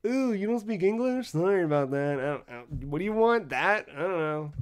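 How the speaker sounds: mock unhelpful worker. Fast-food worker to non-English speaker, mock unhelpful. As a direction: play at brushing off a foreign customer. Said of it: "whereas here we'd be like".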